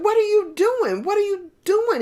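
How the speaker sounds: high, loud exasperated question; annoyed wave. Acted. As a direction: demand it of a friend killing the vibe. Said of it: "the wave behind it is saying".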